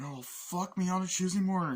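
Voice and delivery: high, loud exasperated outburst; Cage catchphrase. Retold or imitated. Nicolas Cage on the plane, loud high outburst. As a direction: blurt it out on the plane. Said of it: "famous catchphrase".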